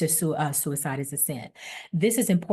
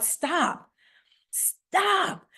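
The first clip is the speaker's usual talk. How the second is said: high, drawn-out call of "stop"; yelled out; 10 semitones higher than usual. Shown as a demonstration. Preacher leading everybody on the call, yelling out. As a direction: call stop twice, pitch leaping up higher the second time. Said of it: "just to yell out".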